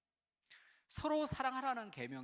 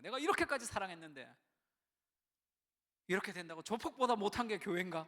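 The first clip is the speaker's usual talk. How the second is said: hurt indignation; 2 semitones higher, 7 dB louder than usual. Retold or imitated. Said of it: "너무 상처가 많은 거예요".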